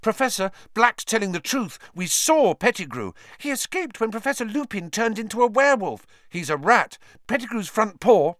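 high, rushed pleading; urgent outburst. Acted. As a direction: rush it out breathlessly. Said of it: "burst into speech".

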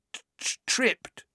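high, stuttering shock; shaky. A narrator's reading. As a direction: stammer it out shakily. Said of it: "said Dudley shakily".

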